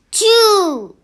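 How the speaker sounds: loud high sneeze burst; big sneeze. Acted. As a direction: let the sneeze explode. Sneeze in the story.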